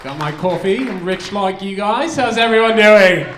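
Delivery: loud, high-pitched mock British greeting; hammed-up British stage hello; British accent. Comic greeting the crowd on stage, in a put-on British accent. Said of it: "they brought a British guy up".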